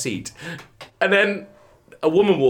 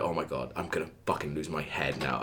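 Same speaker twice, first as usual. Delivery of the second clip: muttering to himself; 12 semitones lower, 13 dB quieter than usual. Retold or imitated. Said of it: "I was like".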